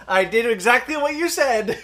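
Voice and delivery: loud, high, drawn-out insistence; mock proud boast. Acting the dad reporting back to Joyce, mock proud boast. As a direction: stretch it out as a pleased-with-himself report.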